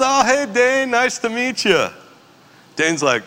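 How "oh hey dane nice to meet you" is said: much higher sing-song friendly lady; sweet old shop lady meeting a newcomer; cheery Bonnie greeting. Retold or imitated. Barista welcoming the brother, high and cheerful.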